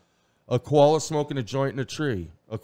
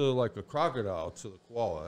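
ordinary talk

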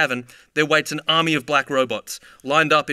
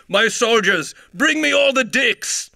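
much higher, loud, slow manic bellow; high-pitched manic voice; 9 semitones higher, 4 dB louder, slower than usual. Acted. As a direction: bellow it like a grinning lunatic. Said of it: "in a high-pitched manic voice he bellows".

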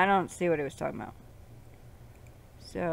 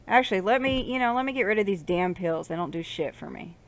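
ordinary delivery

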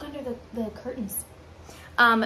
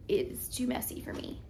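whispering; 8 dB quieter than usual. Real, not acted.

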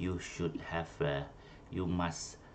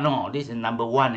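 usual speaking voice

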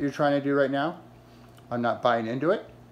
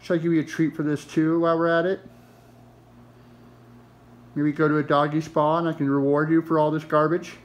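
ordinary talk

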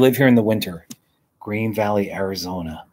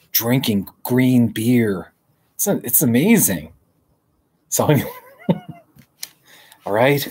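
ordinary talk, nothing marked

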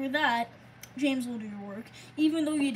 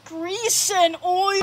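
drawn-out yell building to full volume; panicked shout; 4 semitones higher, 8 dB louder, slower than usual. Acted. Chased engine crying out, long loud panicked shout. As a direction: stretch each word, rising into a high holler.

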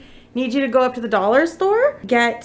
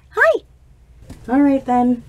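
normal talking voice

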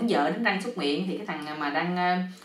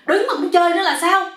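high, loud indignant demand; exasperated yell; 10 semitones higher, 7 dB louder than usual. A narrator's reading. Sleepless man scolding the mosquitoes, exasperated yell. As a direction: yell the question in outrage. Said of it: "ảnh la nữa".

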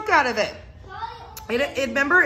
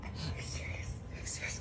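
breathless whisper; whispered disbelief; 19 dB quieter than usual. Real, not acted. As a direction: barely whisper it in pain. In pain, incredulous.

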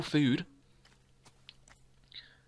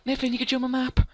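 high, naive mock voice; innocent self-impression; 11 semitones higher than usual. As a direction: chirp it high like an unsuspecting friend. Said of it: "And I'm like".

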